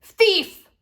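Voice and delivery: loud high shout of an angry gardener; furious yelled warning; calling out. Acted. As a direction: bellow it across the garden. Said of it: "calling out".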